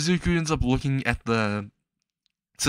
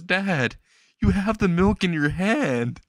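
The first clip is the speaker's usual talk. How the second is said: high-pitched protest to Dad; mildly pleading boy, voice lifted; protesting son; 8 semitones higher, slower than usual. Acted. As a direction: object in a higher voice, not yet crying.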